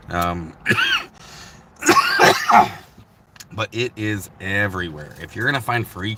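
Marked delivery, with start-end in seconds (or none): 1.8-3.0 sharp smoker's cough; loud cough. Real, not acted. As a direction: cough loudly mid-sentence. Smoking, coughing.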